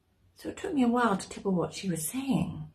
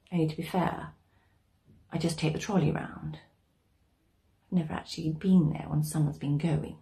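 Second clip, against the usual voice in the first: usual speaking voice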